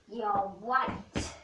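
ordinary talk

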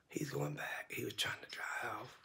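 soft whisper so the bird won't notice; hushed aside, barely voiced. Real, not acted. Whispering not to disturb bird.

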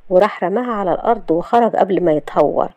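ordinary delivery

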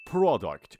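"product" said with a clear "pra" start. Shown as a demonstration. Teacher models the right "product" for students, "pra" opening. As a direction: open the first syllable of "product" as "pra", not "pro".

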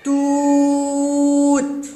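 "توت" with ū held very long on a high level note; strong long damma. Shown as a demonstration. Teacher has children listen for damma, drawn-out monotone. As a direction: intone tūūūt, stretched out. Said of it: "هل تستمعون لصوت الضمة القوية".

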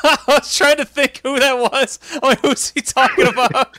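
high, loud, through real laughter; helpless laugh breaking into the words; laughing while talking. Real, not acted. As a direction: crack up while getting the words out. Laughing hard at the name.

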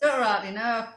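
mock Yorkshire; joining in Yorkshire accent. Co-host joins the ghost tale, mock Yorkshire accent. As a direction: chip in with put-on Yorkshire. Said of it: "in my best Yorkshire accent".

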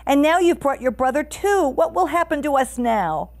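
high, loud storybook panic; hammed-up frightened cry, pitched well up; screaming. A narrator's reading. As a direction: wail in feigned alarm as a ruse. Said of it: "suddenly began to scream".

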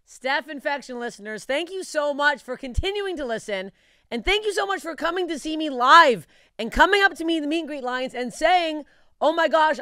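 upbeat podcast ad read, listing dates. Acted. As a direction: sell the tour dates like a host-read ad. Reads her tour dates ad.

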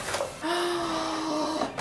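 sharp gasp of delight. Real, not acted. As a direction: gasp at something pretty. Sees the glass unboxed.

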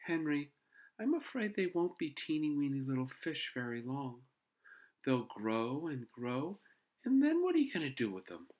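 high-voiced mother breaking bad news; gently warn, with a motherly sigh; sighing concern. A narrator's reading. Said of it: "Missus Huggins sighed".

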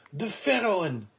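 high-pitched, animated announcement; joyful cry. A narrator's reading. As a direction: shout the news with joy. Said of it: "he cried".

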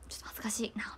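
whispered bashful aside; a shy little whispered line. Retold or imitated. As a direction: breathe it out shyly. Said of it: "みたいな".

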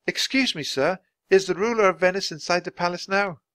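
put-on high, girlish, polite question; polite girl Annie. A narrator's reading. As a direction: ask politely in a high child's voice.

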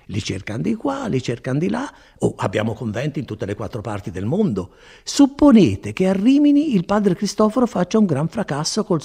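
a hint of a chatty friar, pitch rising and dipping; lightly acted friar, animated explanation; lightly voiced talkative friar. A narrator's reading. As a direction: ramble on proudly as the friar.

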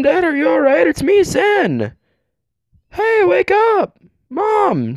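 put-on high, pleading girl's voice calling her parents; a frightened girl trying to wake her mom and dad; scared young girl. Voices the girl in game scenes.

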